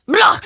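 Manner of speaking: a loud, high dismissive "Blah!"; scornful blah. Real, not acted. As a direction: spit out the "Blah!" in disgust. Scornful outburst about Carl.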